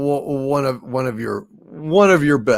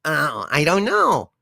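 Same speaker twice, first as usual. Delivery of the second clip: ordinary talk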